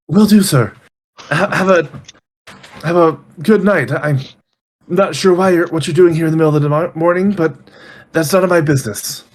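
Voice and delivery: obliging servant minding their place; night-shift servant. Acted. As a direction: bid the master good night, politely baffled.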